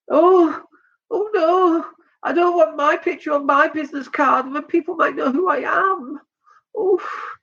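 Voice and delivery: high, whiny-fussy mimicry of a worrier; mock reluctant objector. Host mimicking people shy of photos on business cards, mock reluctant.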